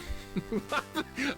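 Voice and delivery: high burst of giggles; exasperated laugh. Real, not acted. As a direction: laugh at your own misery. Greets friend while suffering game.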